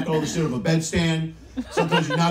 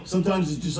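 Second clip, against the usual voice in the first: ordinary delivery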